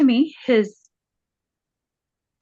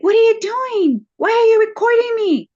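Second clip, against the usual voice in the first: upset; 13 semitones higher, 5 dB louder than usual. Retold or imitated. Confronting husband who records her.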